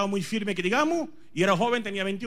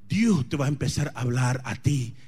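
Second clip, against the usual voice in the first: normal talking voice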